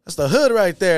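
mock friend exclaiming; imitating friend. Friend exclaiming about the spot.

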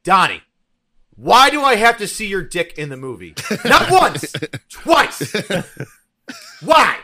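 loud, high exasperated rant; hammed-up outrage, building to a pained yell; angry movie review. Reviewer complaining to a friend about his movie, loud comic anger. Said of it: "I did an angry movie review".

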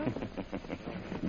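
low, soft sinister chuckle; evil low laugh; villain chuckle. Acted. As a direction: gloat quietly. Villain gloating.

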